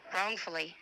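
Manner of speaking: normal talking voice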